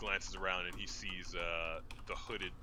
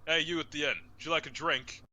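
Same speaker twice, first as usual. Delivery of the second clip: calling out; 8 semitones higher, 8 dB louder than usual. Acted. Bartender calling down bar.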